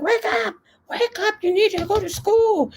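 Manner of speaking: high urgent calling out to wake him; mock frantic wake-up call. Mom at her son's door, high frantic wake-up call.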